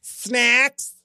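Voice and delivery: high, lilting mock steward voice; flamboyant flight attendant. Comedian retelling Giraldo's bit, high mock steward. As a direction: camp it up on the word. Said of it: "the guy is going".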